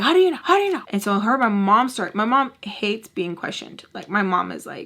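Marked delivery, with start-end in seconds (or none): 0.0-0.8 high, louder imitation of doubt; mock challenging questions. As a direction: mimic a pestering doubter, pitched way up. Voicing Tommy challenging the mother.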